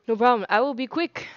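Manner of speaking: ordinary delivery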